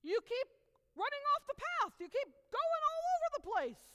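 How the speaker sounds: high, loud exasperated scolding; Balaam yells at his donkey, high and loud; angry Balaam. Acted. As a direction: yell at the donkey in frustration. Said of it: "Balaam says to the donkey".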